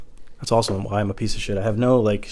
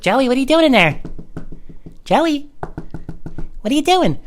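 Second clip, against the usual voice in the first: very high mock-girlfriend voice; high, suspicious girlfriend; nagging girlfriend; 14 semitones higher than usual. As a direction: call through the door in a high put-on voice. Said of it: "your girlfriend's like".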